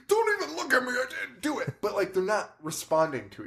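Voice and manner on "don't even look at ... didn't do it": high mock yell of a guilty son; mock scream. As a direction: shriek it defensively, much higher. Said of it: "the son is screaming".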